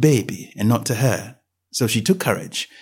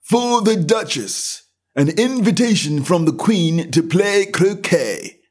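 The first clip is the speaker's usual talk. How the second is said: slow, high and solemn, like a stiff court servant; solemn footman; 8 semitones higher, slower than usual. A narrator's reading. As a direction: announce it gravely, drawn out. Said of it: "saying in a solemn tone".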